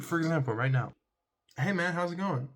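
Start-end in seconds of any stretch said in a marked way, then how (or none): 1.6-2.5 bright, upbeat hello that hides misery; pretending to be okay, casually cheerful; fake cheerful greeting. Shown as a demonstration. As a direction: sound like everything is fine. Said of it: "Does that sound like I'm doing okay?".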